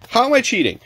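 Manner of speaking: high indignant question; defensive high-pitched demand; mad, yelling. Real, not acted. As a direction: protest it in a high, outraged voice. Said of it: "Now he gets mad".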